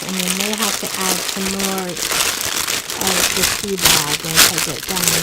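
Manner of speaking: talking over crunchy noise; crunching chips. Real, not acted. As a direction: sound of crunching into mic. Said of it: "Sorry about the crunching".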